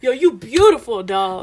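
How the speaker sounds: lightly imitated New York accent; mock-aggressive New York street talk; aggressive New Yorker. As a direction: give a compliment like a gruff New Yorker. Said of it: "angry at you".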